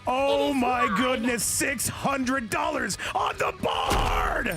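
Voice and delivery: can't believe the prize pool, stretch it; amazed shout. Real, not acted. Reacting to money on the board.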